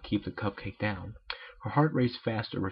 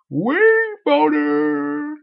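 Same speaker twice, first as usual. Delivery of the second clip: high, very loud, slow drawn-out cry; comic shout; 11 dB louder, much slower than usual. Acted. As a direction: stretch the shout out. Sudden comic exclamation.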